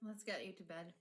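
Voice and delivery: a hint of a tender caretaker; soft and caring. Voices Azra softly.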